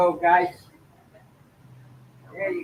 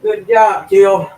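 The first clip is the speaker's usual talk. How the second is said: loud praise; 16 dB louder, much slower than usual. Real, not acted. Praising companion loudly.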